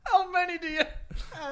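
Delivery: incredulous, high and drawn out with laughter; laughing question. Real, not acted. Co-host heckling the joke teller, laughing. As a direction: ask it high through laughter. Reacting through laughter to jokes.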